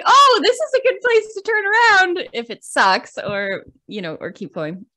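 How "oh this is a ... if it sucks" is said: higher and louder, bubbly self-talk; excited inner thought. Retold or imitated. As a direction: pitch up and sell the escape plan. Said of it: "So I'm like".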